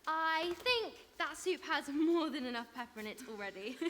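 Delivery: ordinary talk, nothing marked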